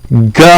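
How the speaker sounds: "G" said as guh, as in ghost; hard G sound. Shown as a demonstration. Quoting the book's Gimel advice, "G" as a hard guh. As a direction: say "G" as a hard guh, not the letter's name. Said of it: "as in ghost, G H ghost".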